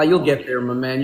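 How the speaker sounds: ordinary delivery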